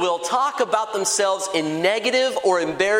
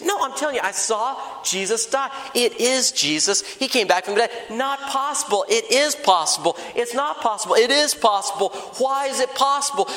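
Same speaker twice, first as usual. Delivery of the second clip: higher-pitched, insistent back-and-forth; heated bickering; 5 semitones higher than usual. Acted. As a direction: argue it out like two stubborn friends. Acted argument between two disciples.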